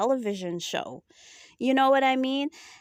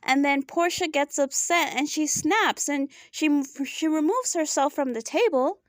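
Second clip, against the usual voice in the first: usual speaking voice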